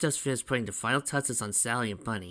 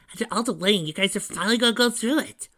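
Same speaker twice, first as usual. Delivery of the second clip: put-on eager young kid sidekick; 12 semitones higher, 7 dB louder, faster than usual. A narrator's reading. Tails waiting outside Sanctuary with the grooms, eager. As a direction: pitch it way up like an eager little brother.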